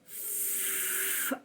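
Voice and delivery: F hissed as a long, soft fff with no voice; teacher showing children the sound F makes; letter sound f. Shown as a demonstration. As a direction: let F out as a steady fff. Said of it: "O sonzinho que ela faz é".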